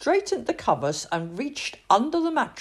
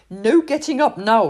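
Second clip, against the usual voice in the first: firm reminder; 2 semitones higher, 8 dB louder than usual. A narrator's reading. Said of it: "reminded the king".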